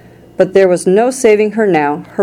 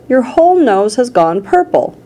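higher, mildly alarmed call; a touch of shouting, pitched up; 6 semitones higher than usual. A narrator's reading. Father alarmed at the nose, mild high shout. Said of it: "shouted Mister Beauregard".

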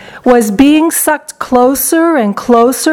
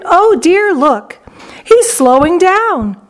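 anxious exclamation; 7 semitones higher, slower than usual. A narrator's reading. Charlie watching boy in the pipe.

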